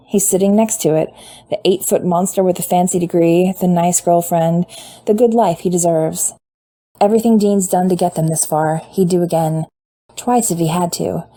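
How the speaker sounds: plain reading voice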